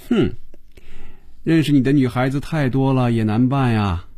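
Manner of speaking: high, needling mockery; sarcastic. A narrator's reading. Phoebe teasing Hongjun about all his girls, sarcastic. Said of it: "露出讽刺的笑容".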